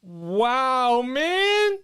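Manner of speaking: high, loud amazed wow. Real, not acted. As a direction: blown away by the guest. Host reacts after the guest leaves.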